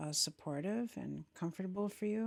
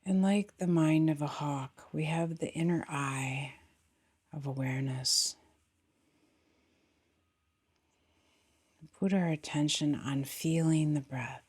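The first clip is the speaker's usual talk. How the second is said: guided meditation; 2 semitones lower, 5 dB louder than usual. Acted. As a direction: guide them gently into the body, unhurried, falling phrase ends.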